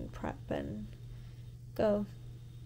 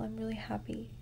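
whispering; 6 dB quieter, faster than usual. Real, not acted. Late night whisper vlog.

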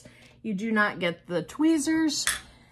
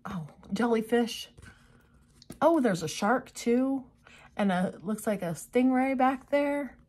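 ordinary delivery

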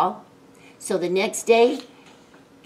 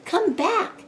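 mock yell, pitched up; calling after; 7 semitones higher than usual. A narrator's reading. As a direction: call after a runaway pet. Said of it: "cried Jake".

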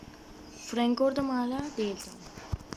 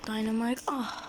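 normal talking voice

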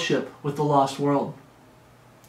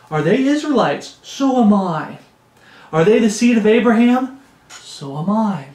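raised, declaiming boast with long stretched answers; carnival preaching; 4 semitones higher, 4 dB louder than usual. Acted. Preacher voicing Paul speaking as a fool, carnival preaching. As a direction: call it out like a showman preacher. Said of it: "I'm getting that carnival preaching going".

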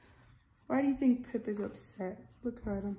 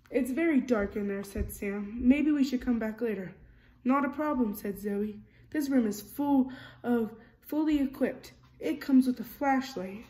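lively storybook reading, character lines a bit louder; reading to children; 5 dB louder than usual. A narrator's reading. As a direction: read it like a bedtime picture book. Reading picture book aloud.

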